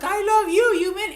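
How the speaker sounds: hammed-up happy dog voice; voicing the dog. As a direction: squeal it back as the adoring dog. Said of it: "And the dog's like".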